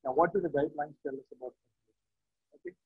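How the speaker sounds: faint, far from the mic; barely audible. Real, not acted. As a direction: voice fading almost to nothing. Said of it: "your voice is very low".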